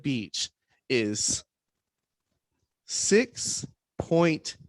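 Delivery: slow, drawn-out announcement with big pauses; dramatic reveal. Real, not acted. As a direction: space out each word of the figure for suspense.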